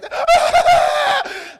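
high, loud hammed-up wail; a showy mourning wail, high and loud; mock wailing cry. Shown as a demonstration. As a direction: wail like a grieving mourner, overdone. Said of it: "Then after crying like this".

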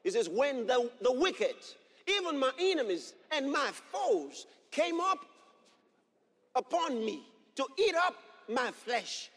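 loud, rising sermon delivery with shouted peaks; fiery preaching. Acted. Pastor proclaims scripture to the congregation, preaching at full force.

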